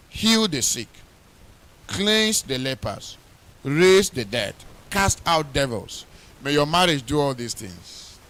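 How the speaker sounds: loud, ringing pulpit preaching; fervent wedding sermon preaching. Acted. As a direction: preach it out with full force. Preaching at full force to couple.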